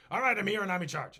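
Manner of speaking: king storming around announcing he's in charge; blustering king. Retold or imitated. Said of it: "storm around and be like".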